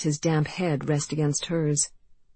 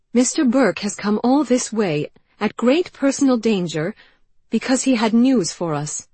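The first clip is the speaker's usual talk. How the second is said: angry; 7 semitones higher, 6 dB louder than usual. Acted. Janey defends Burke angrily.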